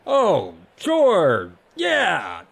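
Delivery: loud, high, drawn-out agreement; big breezy god voice, each word swooping down; offhand god. A narrator's reading. God recalls, loud and raised.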